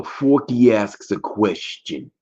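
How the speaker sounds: slow Brooklyn announcer read of Forky asks a question; Brooklyn accent. Retold or imitated. Host imitating the show's Brooklyn narrator. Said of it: "The guy sounds like he's from Brooklyn".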